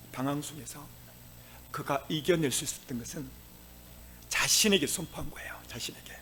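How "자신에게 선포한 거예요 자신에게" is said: hushed emphatic whisper. Real, not acted. Preacher hushing to stress self-proclamation, whispered. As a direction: drop to a secret whisper. Emphatic quiet aside in sermon.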